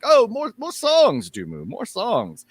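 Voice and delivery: high excited pleading that slows at the end; eager fans. Acted. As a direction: beg the bard for an encore.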